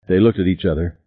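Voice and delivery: ordinary delivery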